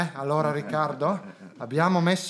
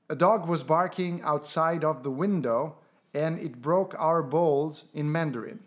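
slow, clear command; slower than usual. Acted.

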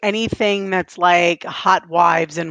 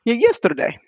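put-on Russian accent; 2 semitones higher than usual. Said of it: "That was Russian".

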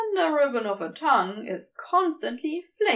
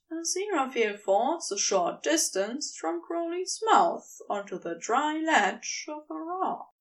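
plain reading voice

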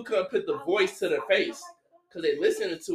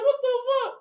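very high-pitched bafflement; retold disbelief; 3 dB louder, faster than usual. Friend stuck watching Roots with the dad, high mock shock. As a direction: squeal it in comic disbelief.

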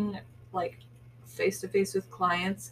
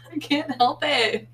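laughing; 6 semitones higher, 5 dB louder than usual. Real, not acted. Just yawned right in my face.

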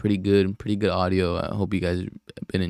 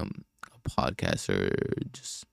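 whispered trailing off; 13 semitones lower, 6 dB quieter, much slower than usual. Real, not acted. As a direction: drop to a whisper.

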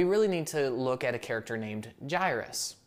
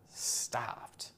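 whispered; 2 semitones lower, 9 dB quieter than usual. A narrator's reading. Dramatic ending of phrase.

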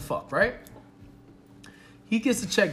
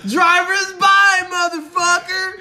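high, loud, stretched-out holler; hammed-up shout, imitating a brazen date; mock shout; 12 semitones higher, 9 dB louder, slower than usual. Date refusing to pay the check, loud high mock shout.

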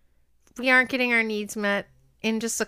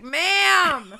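a single sharp bark of ma'am, higher and louder, through a laugh; mock angry yell; 7 semitones higher, 4 dB louder than usual. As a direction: yell it like a fed-up scam caller. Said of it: "No, he was like".

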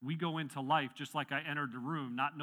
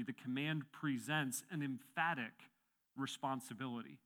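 normal talking voice

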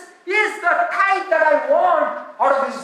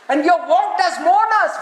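a raised, shouting proclamation; loud lament; 3 semitones higher, 5 dB louder than usual. Acted. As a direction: call it out like a public grievance. Quoting the people's lament.

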